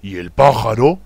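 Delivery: ordinary talk, nothing marked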